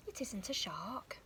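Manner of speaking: ordinary delivery, nothing marked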